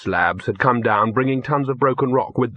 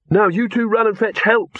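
high-pitched send-off; calling instructions; 10 semitones higher than usual. Acted. Victor sends the dogs for help, calling. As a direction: send them off, pitched well up.

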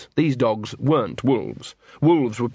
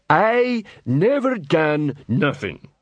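slow, high-pitched troll drawl; sullen troll protest; 5 semitones higher, slower than usual. A narrator's reading. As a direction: drag each word out like a dim troll. Troll suspect protests his innocence.

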